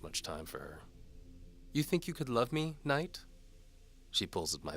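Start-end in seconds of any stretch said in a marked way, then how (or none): 1.7-3.2 higher, louder teasing lady voice; seductive noblewoman. A narrator's reading. Antimony dares the knight to follow. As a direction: tease the knight like a bold noblewoman.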